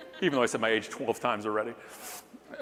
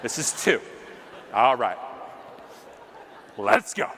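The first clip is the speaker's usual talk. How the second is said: fired up. Retold or imitated. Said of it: "I'm like".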